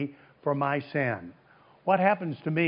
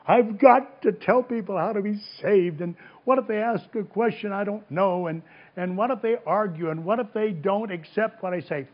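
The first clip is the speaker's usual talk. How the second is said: put-on higher-pitched, fretting young believer; mock worried; 5 semitones higher than usual. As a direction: voice a mild flurry of anxious what-ifs. Voices young people's worry about witnessing.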